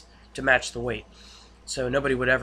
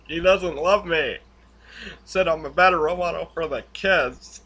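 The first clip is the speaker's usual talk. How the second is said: hammed-up tearful husband pleading; mock crying Walt; 3 semitones higher, 4 dB louder than usual. Walt to Hank, imitating his crying confession, mock sobbing. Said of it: "Hank was so uncomfortable with Walt crying".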